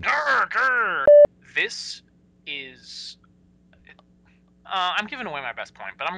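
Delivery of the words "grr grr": high, loud playful grr grr; mock growl. Host playfully growls at wasted screen space, loud. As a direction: growl at the screen like an angry cartoon. Annoyed at browser chrome.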